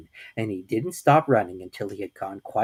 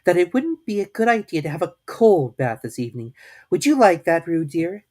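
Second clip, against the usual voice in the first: thoughtful Kanga; 6 semitones higher, 6 dB louder than usual. A narrator's reading. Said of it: "in a thoughtful voice".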